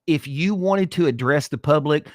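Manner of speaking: usual speaking voice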